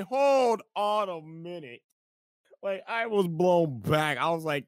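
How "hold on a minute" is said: slow, drawn-out shout of disbelief; stunned shout. Retold or imitated. Kai to Nibley, slow astonished shout. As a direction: yell hold on, stretching it. Blown back by the reveal.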